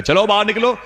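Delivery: high, sharp order to get out; harsh shoo. Retold or imitated. Father driving the small girl from his house, harsh high order. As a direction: drive the child out.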